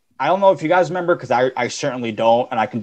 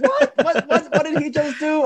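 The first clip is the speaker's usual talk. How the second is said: mock announcer squealing in disbelief, much higher; imitating shocked announcer; 14 semitones higher than usual. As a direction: play the stunned commentator at a yelp-high pitch. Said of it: "He's like".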